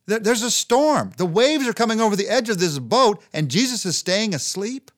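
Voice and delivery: high, loud, urgent amazement; excited preacher marvelling at the scene; amazed storm retelling. Real, not acted. Vividly recounts the storm, amazed.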